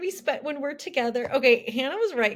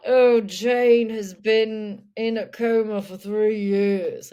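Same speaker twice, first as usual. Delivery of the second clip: slow, lightly drawled mournful man; 7 dB louder, slower than usual. Retold or imitated. Ian explaining wife's coma, slow drawl. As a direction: sigh it out, slow and sorrowful. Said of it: "that's how he talks".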